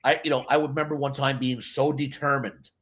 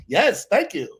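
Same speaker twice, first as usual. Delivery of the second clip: mock gracious thanks; 6 semitones higher, 4 dB louder, slower than usual. Accepting imagined praise.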